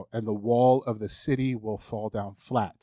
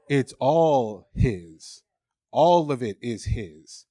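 drawn out and louder, every word weighted; very slow emphatic; 5 dB louder, much slower than usual. Real, not acted. Preacher pressing the truth on the congregation, very slow and weighty. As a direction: let each word land slowly.

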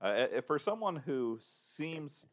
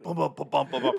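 imitated drum beats; 3 semitones higher, 6 dB louder than usual. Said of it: "verbal abilities to recreate the sounds".

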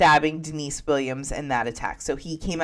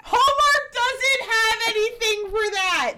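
much higher and slow, a put-on voice echoing the line; high, slow mock voice; 3 dB louder, slower than usual. Host repeating cohost's joke in a high mock voice. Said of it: "That was perfect".

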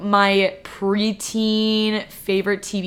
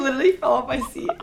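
laughing; 4 semitones higher, faster than usual. Real, not acted. Bloopers, laughing fit.

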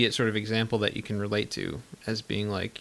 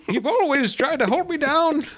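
loud, very high put-on shriek; hammed-up crazy woman hurling accusations; mock unhinged outburst; 6 dB louder than usual. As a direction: wail it like a melodramatic madwoman. Acting out the crazy sister's accusation.

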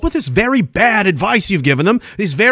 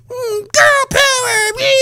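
high, slow mocking falsetto; mock girly voice; slower than usual. Ranting vlogger mocking slogans in squeaky falsetto. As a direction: sneer it in a squeaky put-on voice.

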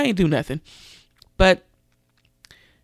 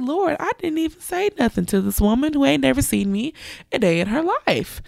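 astonished; 8 semitones higher than usual. Retold or imitated. Speaker's own astonished reaction.

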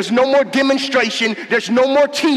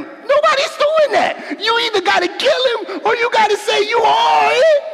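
much higher, shouting preacher; preaching at full force; 10 semitones higher than usual. Acted. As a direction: rise to a fiery cry. Preaching peak.

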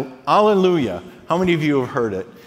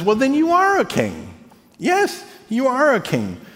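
Pilate, imitated; 8 semitones higher than usual. Pilate voiced in retelling.

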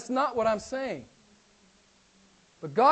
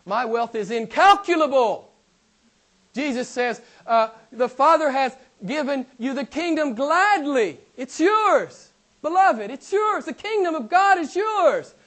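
loud, high-pitched preaching exclamations; impassioned preaching; 4 semitones higher, 5 dB louder than usual. Real, not acted.

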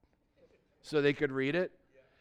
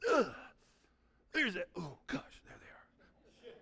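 mock dread; 7 dB quieter, slower than usual. Mock dread seeing offender.

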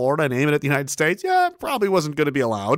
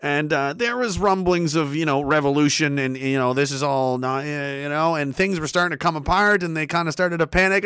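ordinary delivery

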